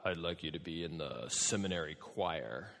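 deep, soft professor voice; really low voice. Acted. Professor recruiting for the seminary choir, really low voice. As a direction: drop low and keep it hushed. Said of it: "in this really low voice".

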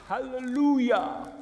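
high, drawn-out preacher's hallelujah; Eddie's preacher hallelujah. Retold or imitated. Pastor imitates brother Eddie's preacher hallelujah. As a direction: stretch it slow like a gospel preacher. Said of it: "my brother Eddie when he says".

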